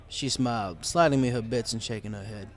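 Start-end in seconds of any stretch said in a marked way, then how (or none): none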